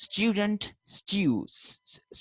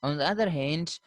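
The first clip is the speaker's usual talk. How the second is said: ordinary talk, nothing marked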